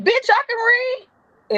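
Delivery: high, drawn-out outraged squeal; indignant retort. Retold or imitated. Offended employee retorting to her boss, high indignant retort.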